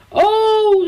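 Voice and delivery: high, loud, startled oh; frightened cry. A narrator's reading. Elsje sees the boat drift away, frightened cry. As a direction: cry out in sudden alarm. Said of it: "riep Elsje verschrikt".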